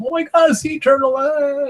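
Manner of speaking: high-pitched excited squeal; mock freak-out. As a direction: act out a giddy panic at a sudden sighting. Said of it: "we'd freak out".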